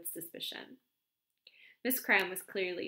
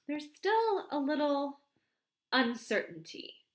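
slow and measured, a touch higher; slowly; 7 semitones higher, 3 dB louder, slower than usual. A narrator's reading. The vicar answering Miss Cram warily, slowly. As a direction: weigh every word carefully. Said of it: "I said slowly".

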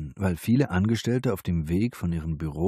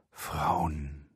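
soft, lower breathed word; softer, lower breathy sigh of a word; 3 semitones lower, 7 dB quieter than usual. A narrator's reading. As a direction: breathe it out dreamily. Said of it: "hauchte er".

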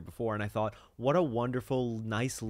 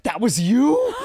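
high, loud shocked outburst; shocked disbelief; 12 semitones higher, 13 dB louder, slower than usual. Acted. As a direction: cry out in stunned disbelief. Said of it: "And she was like".

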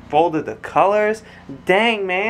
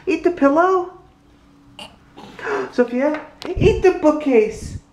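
high playful echo; 12 semitones higher than usual. Real, not acted. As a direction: chant along with her up high. Dad echoing toddler in high voice.

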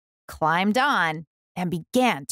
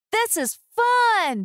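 much higher, slow delighted cry; happy animals; 14 semitones higher, slower than usual. A narrator's reading. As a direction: cheer together on bikes. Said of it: "they all said".

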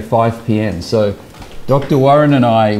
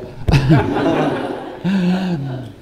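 laugh; 5 semitones higher, 4 dB quieter than usual. Real, not acted. Laughing after his own punchline.

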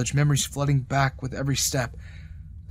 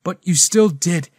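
louder, hurt accusation building to a break; 3 semitones higher, 7 dB louder than usual. A narrator's reading. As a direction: push it out through the hurt. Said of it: "My voice broke".

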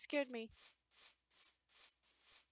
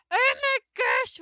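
excited; 9 semitones higher, 10 dB louder than usual. Real, not acted. Excited at recipe.

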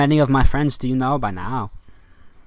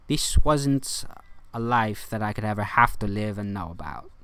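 ordinary talk, nothing marked

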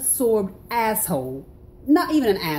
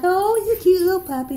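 put-on high, loud baby talk to a dog; cooing at a puppy; 11 semitones higher, 5 dB louder than usual. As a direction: coo over the cute puppy. Cooing over a stranger's puppy.

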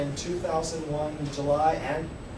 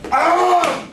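high, loud charging yell; battle yell; 12 semitones higher, 11 dB louder than usual. Retold or imitated. Dad rams the bathroom door, high loud battle yell. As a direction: yell as you ram the door. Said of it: "he just rams it, he goes".

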